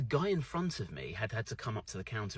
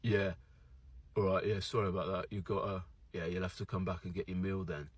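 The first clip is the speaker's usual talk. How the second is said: lower, softer, flat British shrug; bored UK fast-food worker; 7 semitones lower, 5 dB quieter than usual. Retold or imitated. UK worker on the phone about missing fries, low and offhand. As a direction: say it like you couldn't care less. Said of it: "they're like".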